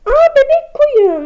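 high, loud, slow coo of delight; delighted squeal. Real, not acted. Gushing over a baby sheep, high delighted coo. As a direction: gush over something cute.

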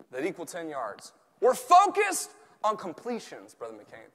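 ordinary delivery

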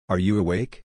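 usual speaking voice

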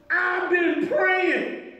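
high, slow preacher's shout imitating a tearful plea; impassioned retold plea. Preacher voicing bawling Sue's prayer, impassioned shouted plea. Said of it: "And she was bawling".

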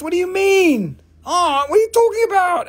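high, loud disbelieving protest; incredulous. Real, not acted. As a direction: cry out in amused disbelief. Laughing disbelief at arm implant.

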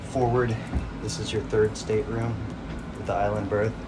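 ordinary talk

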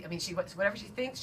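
usual speaking voice

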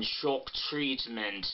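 "Shock" with a small rounded mouth, slow; "Shock Treatment" slow with a tight rounded O; Pat Quinn vowels. Shown as a demonstration. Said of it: "like when she says the O in".

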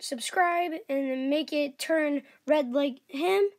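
usual speaking voice

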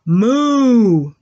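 long, loud imitated moo; drawn-out teacher's moo; cow moo. Shown as a demonstration. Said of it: "what does a cow say".

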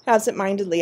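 ordinary delivery, nothing marked

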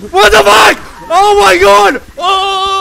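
very high, full-volume shriek of terror; scared scream. Real, not acted. Player screaming at the horror game's jump scare, terrified.

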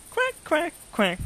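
high, small pretend quacks; duck quacking. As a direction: answer as a little squeaky duck. Voicing the toy duck's quacks.

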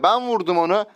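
ordinary talk

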